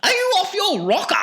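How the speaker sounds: very high, quick falsetto; Wonka's shocked outcry. A narrator's reading. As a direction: squeal it in eccentric disbelief. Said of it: "cried Mister Wonka".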